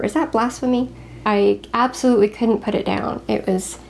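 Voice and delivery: ordinary talk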